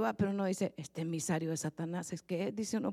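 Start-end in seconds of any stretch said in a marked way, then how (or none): 0.8-2.5 muttered aside. Retold or imitated. Muttered thought about tempter.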